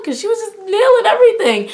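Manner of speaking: high, loud burst of enthusiasm; excited gushing. Real, not acted. Fan raving about the pep rally performance, excited and loud. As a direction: keep the cheering energy up, pitched high. Still worked up from acted cheer.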